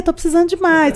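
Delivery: retold eager craving; eager plea. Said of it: "ela lê e fica".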